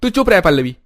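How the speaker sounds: high, loud shut-up; angry snap. Acted. Brother to his sister, angry snap. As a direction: cut your sister off sharply.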